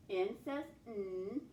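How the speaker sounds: ordinary delivery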